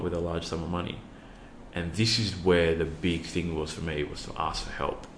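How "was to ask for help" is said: ordinary talk, nothing marked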